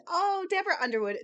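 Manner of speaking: real excitement, "Deborah Underwood" sung up high; delighted recognition. Real, not acted. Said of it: "another personal favorite of mine".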